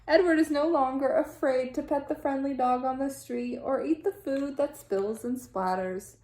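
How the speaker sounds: ordinary delivery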